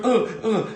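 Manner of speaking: high, loud retold "ugh, ugh" of disgust; mimicked disgusted grunts. Mum crawling out of the pond, high disgusted grunts. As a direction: grunt like you've fallen in a slimy pond. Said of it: "She was going".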